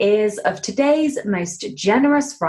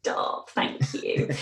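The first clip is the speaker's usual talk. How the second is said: hushed; 7 dB quieter, slower than usual. Real, not acted. Modest reply to praise.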